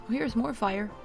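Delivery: ordinary delivery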